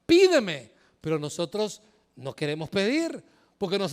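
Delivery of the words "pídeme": higher, louder urgent plea; God pleading. Retold or imitated. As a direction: beg them to ask, rising to the last word. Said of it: "Él te pide". (Dios que ruega, más agudo y fuerte)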